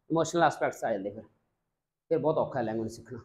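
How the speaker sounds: usual speaking voice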